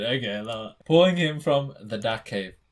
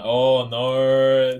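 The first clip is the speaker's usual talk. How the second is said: surprised oh no; 10 dB louder than usual. Real, not acted. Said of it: "reacts in surprise".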